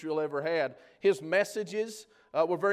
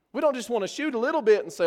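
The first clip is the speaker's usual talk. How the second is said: usual speaking voice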